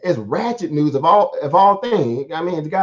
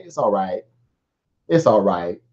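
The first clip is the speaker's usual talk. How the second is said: mock soothing reassurance; 6 semitones lower, slower than usual. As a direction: lull the shareholders, patronizing. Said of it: "that's literally how they talk to you".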